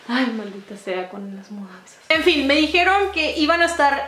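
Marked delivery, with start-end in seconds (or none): 0.1-2.0 low, much softer mutter; grumbling aside. Real, not acted. Aside about having moved house, quiet grumble. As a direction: curse under your breath, pitch dropped.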